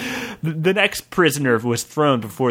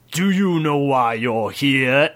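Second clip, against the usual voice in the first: giant voice. Acted. Giant questions goblin.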